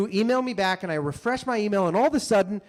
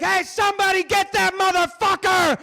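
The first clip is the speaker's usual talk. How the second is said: vendor yelling; 11 semitones higher, 4 dB louder than usual. Retold or imitated. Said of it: "this guy who's working at a hot dog cart is like".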